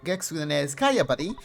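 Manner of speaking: high, loud, eager neighbour voice; boastful chatty kid next door; loud excited neighbour. Acted. Voices game neighbour character.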